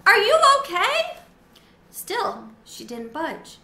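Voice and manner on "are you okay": much higher, much louder squeal; squeaky shout. A narrator's reading. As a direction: shout it at the top of your lungs. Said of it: "squeaked at the top of my lungs".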